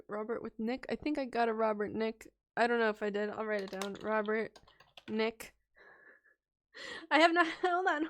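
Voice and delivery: ordinary talk, nothing marked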